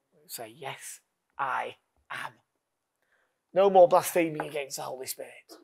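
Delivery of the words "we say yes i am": soft whispered affirmation; hushed, intimate yes. Acted. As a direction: breathe the answer almost silently. Quiet final affirmation.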